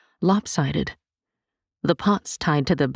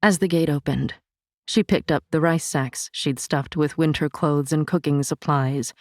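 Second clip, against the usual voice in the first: ordinary delivery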